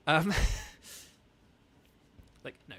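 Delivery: ordinary talk, nothing marked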